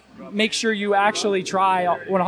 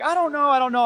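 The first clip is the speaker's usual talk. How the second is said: higher-pitched imitation of unsure people; mock uncertainty; 7 semitones higher than usual. Retelling people's answers to a morbid question, mock uncertainty. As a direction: voice other people dithering, pitched up.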